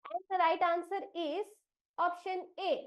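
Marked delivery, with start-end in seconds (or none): none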